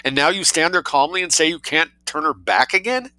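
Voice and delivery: indignant, high-pitched tirade; shouted outrage. A narrator's reading. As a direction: rebuke him in outraged disbelief. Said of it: "shouted Colonel Hampton".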